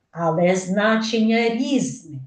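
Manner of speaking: normal talking voice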